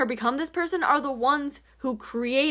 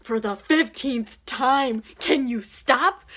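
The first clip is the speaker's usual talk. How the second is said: slow, weary exasperation; exasperated plea; slower than usual. Retold or imitated. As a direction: drag it out, fed up. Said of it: "And I was just like".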